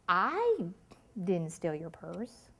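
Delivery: "I" drawn out and set apart, rest dropped low; stress on I. Shown as a demonstration. Trainer showing how emphasis changes meaning, stress on "I". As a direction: lean on "I", then let "didn't steal your purse" fall away. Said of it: "where I put the emphasis".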